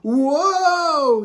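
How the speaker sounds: loud high whoa; hollered. A narrator's reading. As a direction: holler it flying through the air. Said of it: "he hollered".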